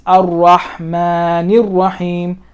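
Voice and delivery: slow Ar-Rahmanir Rahim, the words linked; middle alif skipped. Shown as a demonstration. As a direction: run Rahmanir straight into Rahim. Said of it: "I don't pronounce this alif".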